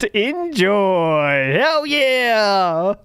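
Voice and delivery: high gloating taunt; gloating mockery. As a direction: sneer it out, high and cocky. Mocking Phil's hidden attitude.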